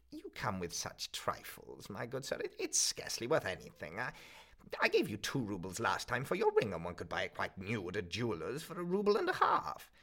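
a miserly crone laying down the rules; the pawnbroker telling the student his pledge has lapsed, coldly; stern old pawnbroker. Acted. Old woman haggles.

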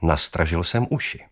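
ordinary delivery

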